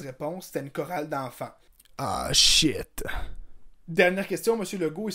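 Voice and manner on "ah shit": caught-out curse. Acted. Legault caught out.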